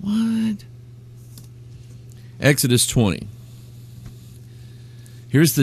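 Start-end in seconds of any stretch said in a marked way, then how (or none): 0.0-0.6 high, hushed, drawn-out what; squinting bafflement. Retold or imitated. Reacting to these people's claim, mock bafflement. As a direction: softly act out puzzled disbelief. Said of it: "they make me squint. I just go".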